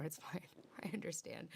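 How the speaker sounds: whispered, out of breath; breathless whisper. Real, not acted. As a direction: breathe it out in a hush. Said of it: "out of breath".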